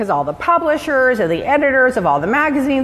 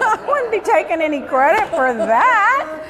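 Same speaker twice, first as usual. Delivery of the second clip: high, laughing disbelief at the outfit; 11 semitones higher than usual. Real, not acted. Host laughing in shock that the designer wears it, high and giggly.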